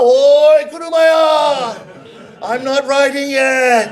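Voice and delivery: loud slow hail; calling after. Acted. Customer shouting after the departing rickshaw man, loud and drawn out. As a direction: shout after him as he runs off.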